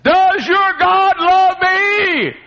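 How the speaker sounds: high, loud, slow echo of her scream; haunting cry. Retold or imitated. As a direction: cry it out as she did, drawn out. Preacher recalling her haunting cry.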